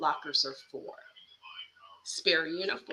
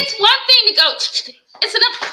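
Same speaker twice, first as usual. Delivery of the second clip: exasperated outburst; 11 semitones higher, 11 dB louder than usual. Acted. Acting out an exasperated scolding.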